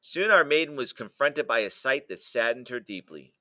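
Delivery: ordinary delivery, nothing marked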